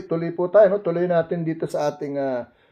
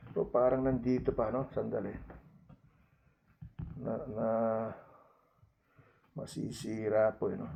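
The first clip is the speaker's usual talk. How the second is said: muttered aside; 6 semitones lower, 13 dB quieter than usual. Real, not acted. Fumbling with a torn Bible.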